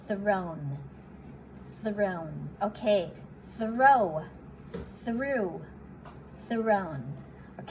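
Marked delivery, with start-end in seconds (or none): 0.1-2.3 "thrown" with TH-R, closing on N; careful pronunciation demo. Shown as a demonstration. Teacher reads out the third verb form, careful "thrown". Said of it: "อ่านเป็น". 3.6-7.0 "throw", "through", "thrown" each set apart by pauses; careful word-by-word demo. Shown as a demonstration. Teacher recaps three verb forms for learners, careful word-by-word demo. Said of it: "เรื่องการออกเสียงคำว่า".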